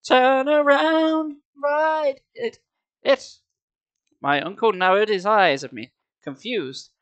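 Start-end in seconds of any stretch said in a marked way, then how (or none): 0.1-3.4 high, drawn-out warning yell; high urgent holler; acted scream. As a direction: yell a slow, stretched warning. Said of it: "I finally managed to scream".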